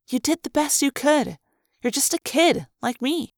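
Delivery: put-on a young girl's sincere comfort. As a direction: lift him up, like a young friend. Lauren comforts him.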